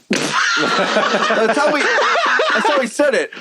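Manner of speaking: can barely get it out for laughing. Real, not acted. Retelling the cigarette guy to friends, laughing hard.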